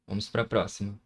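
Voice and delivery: usual speaking voice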